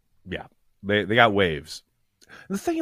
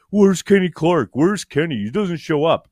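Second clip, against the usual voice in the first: mock outcry, pitched up and louder; complaining Twitter fans; 5 semitones higher, 4 dB louder than usual. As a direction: mimic griping fans demanding answers. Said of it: "stragglers ... on Twitter who are like".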